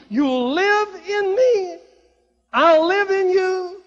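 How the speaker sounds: high, loud, slow, near-sobbing; tearful cry. Acted. Preacher crying out Jesus' words, voice breaking. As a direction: let the voice break on it.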